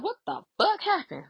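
high-pitched, incredulous mimicry; swooping high mock shock; shocked question. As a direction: imitate someone demanding to know what happened. Retelling friend's shocked question.

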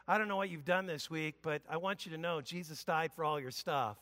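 a friend softly assuring forgiveness; gentle reassurance. Retold or imitated. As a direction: tell it like a kind friend. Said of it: "comes to you ... and says".

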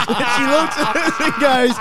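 slow words through laughter; talking while cracking up; laughing. Real, not acted. Friend retelling old lady's complaint, laughing.

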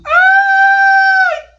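sudden high shrill cry of pain; girl's scream. Acted. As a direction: shriek it like a scared little girl. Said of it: "a pirra dá um grito".

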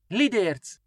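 mild excited call, pitched high; little girl calls out in delight. A narrator's reading. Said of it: "kiáltott fel Csenge".

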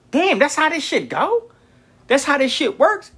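high, retold shock; stunned realisation. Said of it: "'Cause I'm like".

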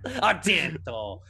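seethe the rival's name slowly; slow mock Italian. Fulci brooding over Argento, slow mock Italian. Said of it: "He's like".

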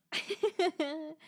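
short, high-pitched burst of laughter; amused laugh. Real, not acted. Vlogger amused by her ASMR confession, high laugh. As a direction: laugh at your own bit.

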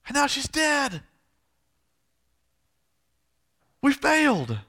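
high, loud indignant protest; angry outburst. Acted. Father told his daughter is dead, angrily blaming Jesus. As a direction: raise the voice sharply in bitter accusation. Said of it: "now it's turned to anger".

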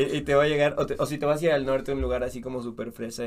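normal talking voice

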